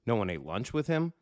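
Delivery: usual speaking voice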